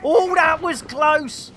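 high, loud panicky outburst; worked-up gamer losing control; frantic shouting. Real, not acted. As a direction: yell in flustered exasperation mid-fight. Frantic moment in game fight.

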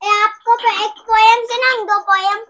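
high, loud, quick burst; excited, high-pitched offer; eager offer. Real, not acted. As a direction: pipe up eagerly to show off a poem. Child eagerly offers to recite poems.

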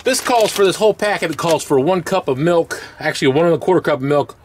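usual speaking voice

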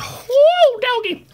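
very high, loud whoop; whooping cheer. Real, not acted. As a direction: cut loose with a whoop. Reaction cheer.

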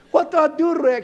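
high, loud mock plea of a wife; imitating a wife. Wife pleading with Rick for help, a high mock plea. Wife voiced asking husband.

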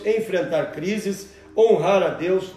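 usual speaking voice

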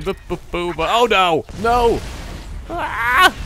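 hammed-up wailing alien, rising into a loud high no; mock dying enemy. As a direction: play the doomed alien going down, overdone. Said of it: "going like this and going".

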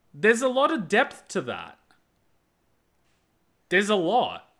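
moved, holding it together; emotional. Real, not acted. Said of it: "I'm getting a little bit emotional".

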